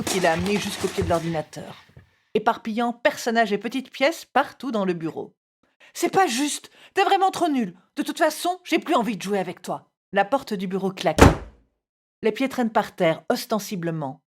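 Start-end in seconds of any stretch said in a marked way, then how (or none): 5.9-9.9 put-on high, indignant child protest; enfant en colère. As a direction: throw a small tantrum in a raised pitch. Enfant fâché proteste et donne un coup. (protestation aiguë d'enfant fâché)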